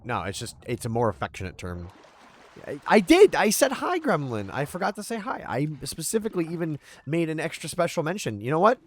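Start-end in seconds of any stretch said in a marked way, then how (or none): 2.7-8.8 high-pitched, playful protest; mock indignant. Streamer defending their greeting to Gremlin, mock indignant. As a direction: protest your innocence, pitched well up.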